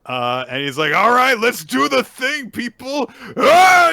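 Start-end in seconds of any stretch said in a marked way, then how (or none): 1.0-3.1 loud, high rallying yell; rally the crowd at full volume; hype-up shout. Retold or imitated. Host voicing performer taking the stage, shouted hype-up. 3.4-3.9 loud high-pitched yell; hype-up yell. Retold or imitated. Host imitating performer hyping himself up, high yell.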